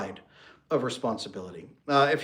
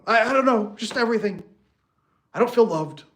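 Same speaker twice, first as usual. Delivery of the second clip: much higher, louder, whiny upset wife; hammed-up overwhelmed wife; distraught wife; 8 semitones higher, 5 dB louder than usual. Wife complaining vaguely to husband, mock distraught high voice.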